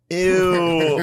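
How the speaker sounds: high, loud mock "Ew" of disgusted teen girls; grossed-out girls. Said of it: "they're all gonna be like".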